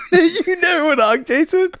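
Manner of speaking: incredulous laughing question; laughing disbelief. Real, not acted. Amazed friend never chased hogs.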